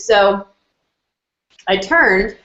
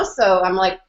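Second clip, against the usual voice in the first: normal talking voice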